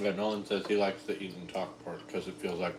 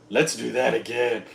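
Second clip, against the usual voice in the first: eager; 4 semitones higher, 7 dB louder than usual. Retold or imitated. Retelling her eager reaction.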